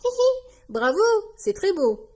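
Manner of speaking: high, loud giggly praise; delighted compliment. A narrator's reading. Peppa compliments George's costume, giggly and high. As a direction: gush with a squeaky hee-hee. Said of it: "le complimente Peppa".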